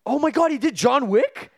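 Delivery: shocked high outburst; surprised. Real, not acted. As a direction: gasp it out in disbelief.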